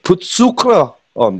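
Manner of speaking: put-on high, slow German accent on "Put sucre on"; German woman's accent. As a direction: mimic a German woman offering advice, slowly. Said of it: "this fucking German woman, um, said".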